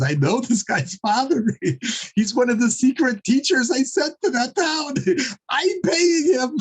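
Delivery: high-pitched, chuckling delight; tickled by a secret irony; amused realization. Retold or imitated. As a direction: voice an inner thought through bubbling laughter. Acts out Rebbe's amused private thought.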